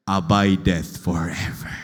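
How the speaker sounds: low, loud, slow proclamation; solemn booming scripture close; deep proclaiming. Real, not acted. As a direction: lean low and hard on the verse's end. Reading scripture climax.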